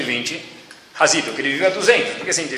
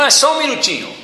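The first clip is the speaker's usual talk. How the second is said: pleading plea; 9 semitones higher, 4 dB louder, faster than usual. Retold or imitated. Man pleads at the door.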